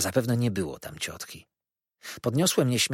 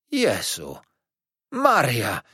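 startled cry, jumping louder on the second word; shocked exclamation; 2 semitones higher, 4 dB louder, much slower than usual. A narrator's reading. Aunt learning who the boy is, shocked exclamation. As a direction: exclaim in shock, holding back then bursting out. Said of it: "zawołała i usiadła na ścieżce".

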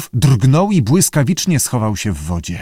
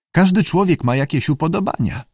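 plain reading voice

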